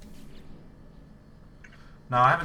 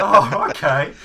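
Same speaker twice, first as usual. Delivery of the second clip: stunned; 7 semitones higher, 15 dB louder, slower than usual. Retold or imitated. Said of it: "I was like".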